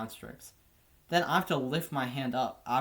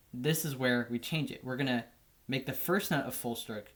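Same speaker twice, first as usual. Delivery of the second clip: normal talking voice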